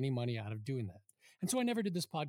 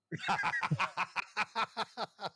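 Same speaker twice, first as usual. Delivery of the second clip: hearty laugh; 4 semitones higher than usual. Real, not acted. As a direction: burst out laughing. Laughs at thirteen years remark.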